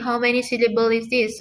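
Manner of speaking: ordinary talk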